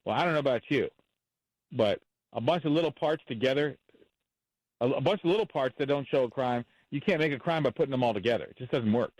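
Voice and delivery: usual speaking voice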